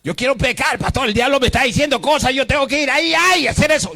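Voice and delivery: mock a member begging to go sin; hammed-up whiny churchgoer; whiny sinner. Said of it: "Me coge la gente".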